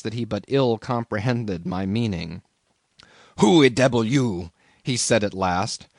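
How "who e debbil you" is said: gruff broken-English harpooneer; Queequeg voice. A narrator's reading. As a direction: bark it at a stranger in bed. Cannibal challenges in the dark.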